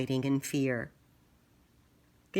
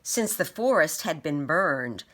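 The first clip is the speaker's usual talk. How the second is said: ordinary delivery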